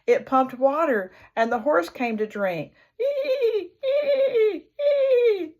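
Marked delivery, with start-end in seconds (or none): 3.0-5.6 high squeaky creaking; creaking windmill. Acted. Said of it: "said the windmill".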